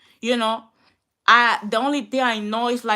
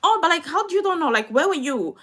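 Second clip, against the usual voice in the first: mocking the school staff; 6 semitones higher, 4 dB louder, faster than usual. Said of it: "they'll call you like".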